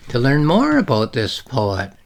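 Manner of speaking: normal talking voice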